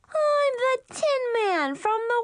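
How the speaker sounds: put-on very high, excited little-girl voice; playful little sister. Little sister pretending in the kitchen, squealing. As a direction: proclaim it in a high childish squeal.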